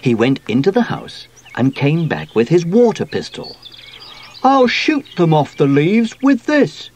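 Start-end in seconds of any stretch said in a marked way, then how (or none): 4.4-6.9 high, raised eager voice; excited cry. A narrator's reading. Sooty brandishes his water pistol, crying out eagerly. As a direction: cry it out boldly. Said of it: "he cried".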